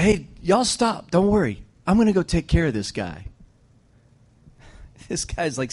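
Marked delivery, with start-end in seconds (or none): 0.0-3.3 put-on higher-pitched, unhurried teenage bravado; cocky young David. Young David telling Saul not to worry, high-voiced bravado. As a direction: play a kid waving off everyone's worry.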